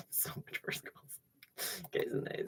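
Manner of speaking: soft unvoiced whisper. Real, not acted. Streamer whispering a sign-off to chat, unvoiced. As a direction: breathe it to the mic.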